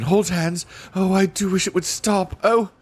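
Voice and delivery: anxious. Acted. Being dragged, wishing it stopped.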